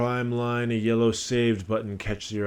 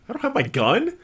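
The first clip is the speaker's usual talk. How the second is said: high, loud panicked disbelief; shocked question; 8 dB louder than usual. Acted. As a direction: squeak it out in sudden alarm. Cop realizes his gun missing.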